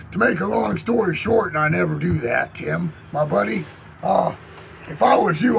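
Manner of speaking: ordinary delivery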